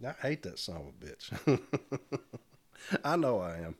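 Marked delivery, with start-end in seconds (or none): 0.1-1.6 quiet, through laughter; laughing. Retold or imitated. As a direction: giggle it out softly. Repeating the quote while laughing.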